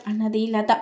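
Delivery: usual speaking voice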